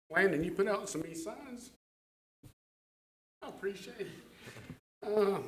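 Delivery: holding back tears as he thanks; emotional. Real, not acted. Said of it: "I am emotional about getting back on".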